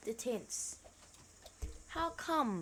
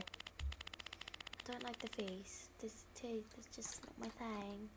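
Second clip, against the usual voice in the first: whisper; 12 dB quieter, much slower than usual. Real, not acted. Whispering about scary monster.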